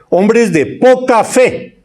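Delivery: a light voiced rebuke of the disciples; Jesus rebuking.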